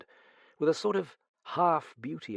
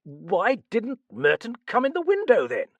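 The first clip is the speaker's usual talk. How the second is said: high, loud, demanding question; baffled outburst; 12 semitones higher, 8 dB louder than usual. A narrator's reading. Sir John challenging the detective's riddle, exasperated. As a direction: demand an answer, voice raised.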